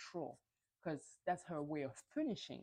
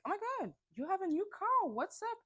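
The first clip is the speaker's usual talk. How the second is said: much higher, quick excited gushing; 3 dB louder, faster than usual. Retold or imitated. Whitney to Monica in the parking lot, excited high gushing. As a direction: gush over a friend's new car. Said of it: "So Whitney is like".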